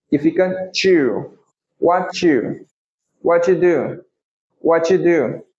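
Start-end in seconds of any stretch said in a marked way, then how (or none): 1.8-5.4 "What you do" with T and you merged into "watchu"; T and you joined. Shown as a demonstration. Teacher shows students the fastest "What you do". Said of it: "junta esse T com you".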